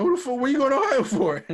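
high, loud disbelieving yell at the guy; incredulous shout. Retold or imitated. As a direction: bark it out like you can't believe the Greyhound guy. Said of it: "I'm like".

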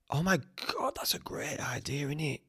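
hushed, amazed realisation; awed whisper. Real, not acted. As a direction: whisper it as the idea dawns on you. Struck by his own idea.

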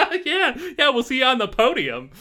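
high, loud scoffing through a laugh; mocking laugh. Band laughing at their rivals, mocking and loud. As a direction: laugh off the challenger. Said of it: "they all kinda laugh".

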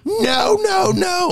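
very high, loud whiny no; toddler refusing. Retold or imitated. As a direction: squeal it like a stubborn toddler. Dad voicing refusing toddler.

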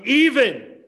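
EVEN leaps high and loud, then falls; stress on EVEN. Real, not acted. Preacher driving home a verse's key word, stress on EVEN. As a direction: punch "even" with a big swooping emphasis. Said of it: "I like the emphasis on the word even".

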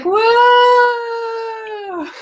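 high excited whoa; mock whoa. Mocking Harry's delighted yelp in the letters. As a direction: squeal it like a raver.

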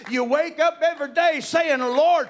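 high, loud preaching building to a shout; full-force preaching. Real, not acted. Preacher urging church folk to daily surrender, impassioned preaching. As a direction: cry it out to the congregation, climbing in pitch and power.

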